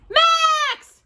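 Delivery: high, loud scream. A narrator's reading. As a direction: scream for her brother. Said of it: "screamed Danny".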